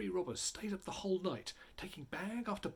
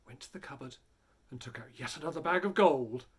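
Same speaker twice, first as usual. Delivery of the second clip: usual speaking voice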